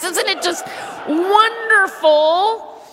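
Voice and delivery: mock gushing. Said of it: "mock gushing".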